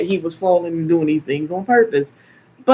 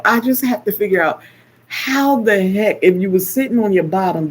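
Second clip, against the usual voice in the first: usual speaking voice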